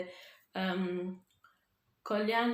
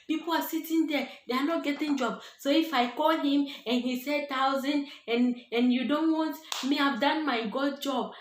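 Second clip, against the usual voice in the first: raised, higher-pitched and louder, an irritated friend pushing back; 4 semitones higher, 3 dB louder than usual. Retold or imitated. Friend snapping back at her over the salary, angry and loud. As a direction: push back hard, annoyed and raised. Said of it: "Then she was getting angry with me".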